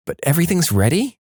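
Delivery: double-check it with a quick lift; puzzled question. A narrator's reading. Wife checking with sleeping husband, questioning.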